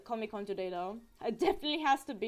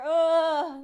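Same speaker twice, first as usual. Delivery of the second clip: loud, higher groan of regret; frustrated groan; 5 semitones higher, 11 dB louder than usual. Real, not acted. Fan who didn't manage to get that cosplayer, frustrated groan. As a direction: groan over a missed chance.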